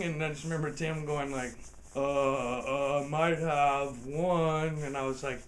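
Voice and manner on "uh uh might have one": sheepish hesitant admission. Retold or imitated. Tim sheepishly admits warrant.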